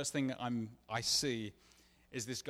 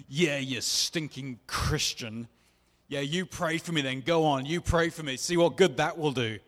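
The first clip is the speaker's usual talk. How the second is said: mocking yell; 3 semitones higher, 9 dB louder than usual. Abuser mocks him, yelling.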